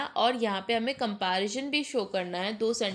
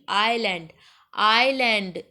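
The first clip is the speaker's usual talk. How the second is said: "island" said alone, stretched, the second louder; stress on Island; 4 dB louder, much slower than usual. Shown as a demonstration. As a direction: sound out "island" so its first vowel stands out. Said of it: "ई का sound आ रहा है".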